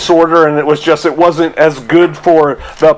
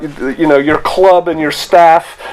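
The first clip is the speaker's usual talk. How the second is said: usual speaking voice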